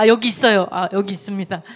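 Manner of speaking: normal talking voice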